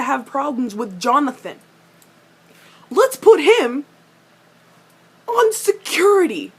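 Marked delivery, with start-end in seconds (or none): 2.9-6.5 much higher, louder exasperated mimic; loud mocking repeat. Fan mocking Alec's bad plan, loud exasperated mimic. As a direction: throw the line back in disbelief. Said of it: "Let's put the new guy on".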